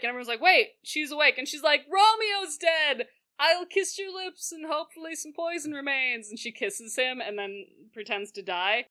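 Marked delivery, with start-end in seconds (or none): 0.4-1.4 louder, higher mock surprise; startled. Everyone sees Juliet awake, startled. 1.9-6.3 high mock-tragic declaration; theatrical lament. Juliet pretends to die by Romeo, theatrical.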